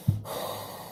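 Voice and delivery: slow deep breath out through the mouth; long calming exhale. Shown as a demonstration. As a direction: let out one long audible breath to calm down. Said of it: "I see my parents and I do that".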